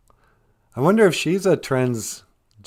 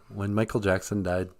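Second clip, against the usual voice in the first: ordinary talk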